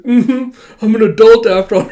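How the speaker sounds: high, loud strained bravado; holding back tears. Acted. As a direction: insist hard while trying not to cry. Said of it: "trying so hard not to cry".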